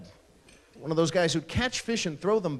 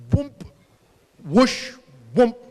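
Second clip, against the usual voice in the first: loud, short bursts of air igniting; mouth-made whoosh of a fireball; imitated whoomp of igniting; 6 semitones higher, 6 dB louder, slower than usual. Lighter fluid hitting the coals, imitated whoomp. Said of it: "just like the sound of air igniting".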